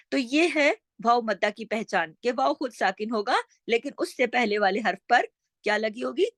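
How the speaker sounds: ordinary talk, nothing marked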